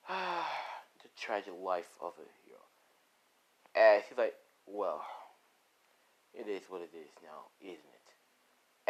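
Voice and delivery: sigh, then low, soft mock pity; gloating villain. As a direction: muse quietly over a victim's fate. Villain gloating over victim.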